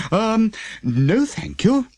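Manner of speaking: politely refuse, uneasy; lightly voiced wary postman; nervous postman. A narrator's reading. Postman declines.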